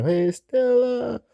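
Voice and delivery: high, slow, drawn-out mock bellow of "Hey, Stella"; Streetcar Stella yell. Fan recalling Streetcar Named Desire, imitating the famous Stella yell, slow and high. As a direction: stretch out Stella like the famous movie plea. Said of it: "he was in Streetcar Named Desire".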